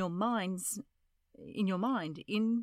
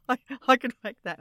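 ordinary talk, nothing marked

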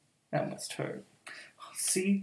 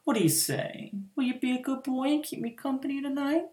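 ordinary delivery

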